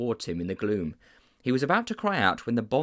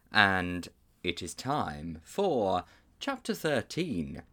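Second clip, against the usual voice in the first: slow, drawn-out chapter call; slow announcement; 3 semitones lower, much slower than usual. Real, not acted. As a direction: stretch out every word of the chapter title.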